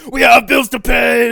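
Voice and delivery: loud, high, drawn-out shout in imitation; hammed-up furious husband shout; imitated yelling husband. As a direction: yell it like the angry neighbor. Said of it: "like, yelling at his wife".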